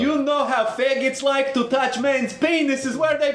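high, slow mock Russian accent. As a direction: put on a thick Russian accent. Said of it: "doing a Russian accent".

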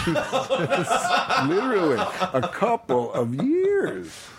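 high, slow, through laughter; rueful laughing emphasis. Real, not acted. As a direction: laugh it out at his own folly. Laughing at himself.

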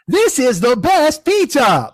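slow, high text-to-speech lady; high mock robot announcer; cheerful robot lady. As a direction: parody a chirpy computer voice, stretched out and high. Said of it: "that cheerful robot lady".

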